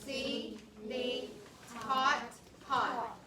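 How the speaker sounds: "Lawn can see the hot pot" read very slowly, word by word; teacher leading the class to read a sentence; slow choral reading. Shown as a demonstration.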